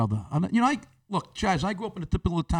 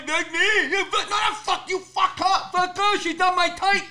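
high, imitated deaf speech, flustered; deaf man's voice; 11 semitones higher than usual. Deaf guy protesting about the lady, high imitated voice. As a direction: put on the mock deaf accent, agitated. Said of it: "The deaf guy goes".

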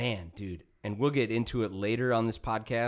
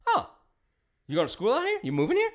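pleasantly surprised trooper; 12 semitones higher, 4 dB louder, faster than usual. Retold or imitated. Trooper suddenly friendly.

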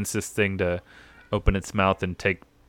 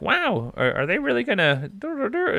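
squeaky high mock-wonder voice, much higher; mock amazed kid. Jason gawks at the whale, high amazed voice. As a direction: play the wide-eyed kid, gasping at the giant beast. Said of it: "Jason's like".

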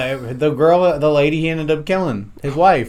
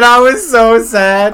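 higher, slow, whiny mimicry; mocking whiny echo; 7 semitones higher, 6 dB louder, slower than usual. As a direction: mimic a friend's whine, drawn out. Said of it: "He said".

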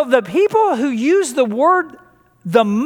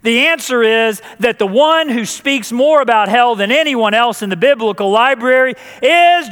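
ordinary delivery

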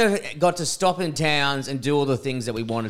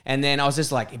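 ordinary talk

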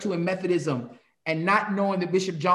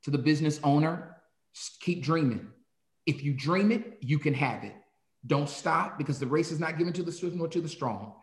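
pastoral closing blessing; 4 semitones lower, 5 dB quieter than usual. Acted.

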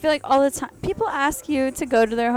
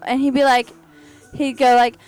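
normal talking voice

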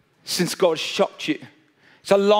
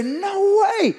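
high-pitched "No way"; mock disbelief, voice jumping up; playing the shocked listener; incredulous; 12 semitones higher than usual. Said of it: "Everybody who listens to this story say".

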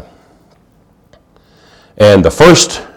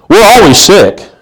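mock complaint; 9 semitones higher, 3 dB louder, slower than usual. Mocking people's negative talk.

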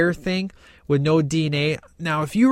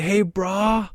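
Bill and Ted surfer; 5 semitones higher than usual. Retold or imitated. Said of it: "talking exactly like, you know, Bill and Ted".

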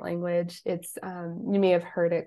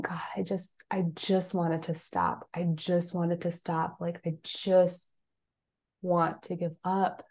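whispered, slow, near giving up; a retold hushed plea; despairing whisper; 5 dB quieter, slower than usual. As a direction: breathe it out, almost unvoiced, at the end of your rope.

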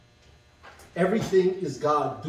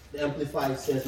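usual speaking voice

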